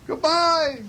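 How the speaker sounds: very high echoed goodbye; moon's echo. A narrator's reading. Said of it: "said Moon".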